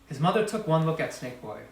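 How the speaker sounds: plain reading voice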